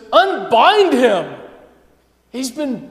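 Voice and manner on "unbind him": slow, firm command. Acted. Jesus orders the crowd, slow firm command. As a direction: order them to unbind him. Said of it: "Jesus says".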